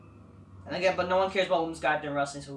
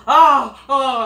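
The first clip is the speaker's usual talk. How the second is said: hammed-up mock moan, much louder; 5 semitones higher, 13 dB louder than usual. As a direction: imitate a moan to mock her. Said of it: "they're moaning".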